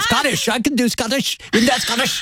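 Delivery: high, badly faked Scottish accent; high-pitched, unconvincing Scottish accent; attempted Scottish accent. Shown as a demonstration. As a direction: try a Scottish accent and miss. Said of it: "Scottish accent".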